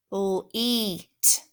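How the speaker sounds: LEET broken into a short L, then a long EE and T; sounded out. Shown as a demonstration. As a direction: stretch out each sound in LEET. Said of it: "stretch, and sound out these words".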